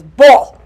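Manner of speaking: "bo" said loud and short; Korean word bo. Retold or imitated. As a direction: punch out "bo" like a Korean command. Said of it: "try to say it in this stupid Korean accent".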